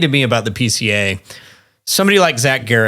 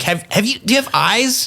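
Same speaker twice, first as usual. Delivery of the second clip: incredulous; 11 semitones higher than usual. Retold or imitated. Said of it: "And I'm like".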